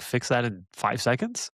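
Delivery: ordinary talk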